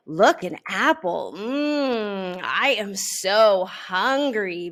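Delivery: lightly voiced greedy Benny, loud; Benny gloating over the apple; delighted bully. A narrator's reading. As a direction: relish the stolen apple. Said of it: "Benny says with delight".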